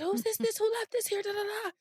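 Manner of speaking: high, soft imitation of a Spanish accent; thick Spanish accent. Spanish teacher asks who left this, in a thick Spanish accent. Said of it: "like this thick Spanish accent".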